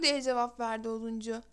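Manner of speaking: ordinary delivery, nothing marked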